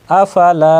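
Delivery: আফালা with the final লা held long; drawn-out final vowel. Shown as a demonstration. Teacher showing learners the alif madd, final লা drawn out. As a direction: stretch the লা at the end of আফালা. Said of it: "টানতে হবে".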